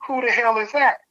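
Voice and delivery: way up high, squeaky disbelief; high, startled who-the-hell; baffled. Retold or imitated. Asking the coworker who Lou is, squeaky and baffled. Baffled question about loup-garou.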